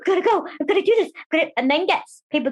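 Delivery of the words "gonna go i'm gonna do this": high-pitched, gasping and rushed; breathless, hurried high voice, raring to go; over-eager competitive self. Acted. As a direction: act out frantic eagerness to compete. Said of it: "competitive [name], who's already like".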